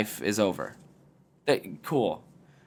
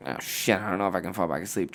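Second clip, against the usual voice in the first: quieter, lower, fast mumble; hurried, hushed fretting at five thirty; mock worried sleeper; 6 semitones lower, 5 dB quieter, faster than usual. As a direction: play the anxious insomniac mumbling. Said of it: "then you're like".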